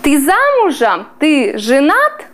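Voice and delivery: ordinary delivery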